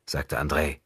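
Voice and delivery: ordinary delivery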